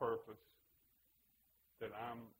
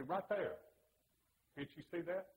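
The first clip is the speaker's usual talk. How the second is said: higher, exasperated retort, mildly acted; mad student; 6 semitones higher, 3 dB louder than usual. Student answering his teacher about the passage, mildly mad. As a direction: retell an indignant comeback, pitched up.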